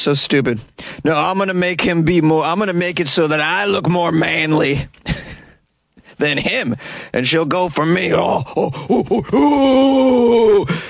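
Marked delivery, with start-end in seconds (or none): 1.0-8.1 higher, cocky mock voice; sneering parody of a dim macho guy; mock dumb vocalist. Mocking the vocalist's scheme. 8.1-10.8 mock caveman grunts. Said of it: "Did he pound his chest".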